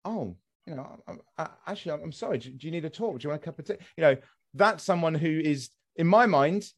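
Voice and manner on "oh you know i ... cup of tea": soft, hesitant, caring offer; genuine sympathy. Acted. Son acting someone offering talk and tea, sincerely sympathetic. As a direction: speak much softer, gently feeling for the words. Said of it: "somebody who's actually sympathetic".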